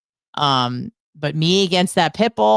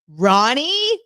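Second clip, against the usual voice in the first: "Ronnie" rising high and louder in disbelief; incredulous; 8 semitones higher, 4 dB louder than usual. Retold or imitated. Hearing the hated dog's name from dad, incredulous. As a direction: throw back "Ronnie" as an appalled question.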